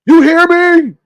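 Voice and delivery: imitating the streamer's loud, high angry voice; imitated angry voice. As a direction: mimic his angry yelling, high and loud. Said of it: "That's angry voice".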